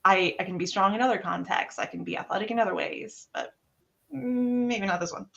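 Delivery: a light, kind inner voice making excuses; reasoning self-talk. Retold or imitated. Athlete telling herself it's okay on a hot marathon day, lightly voiced.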